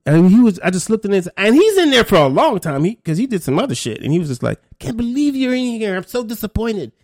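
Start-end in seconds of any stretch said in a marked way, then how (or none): 4.8-6.9 higher, mock-scolding shake of the head; mock disappointment. Inmate to his old social worker, a mildly mocking disappointed tone. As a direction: play the inmate pretending to be let down.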